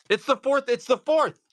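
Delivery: "It's the fourth" blurted high and loud, twice; thrilled he just remembered the date; excited. Real, not acted. Streamer reminding viewers it's May the fourth, excited.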